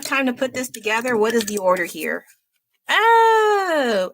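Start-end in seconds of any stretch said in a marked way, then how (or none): 2.9-4.1 high delighted cry; excited gasp. Real, not acted. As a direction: squeal with delight.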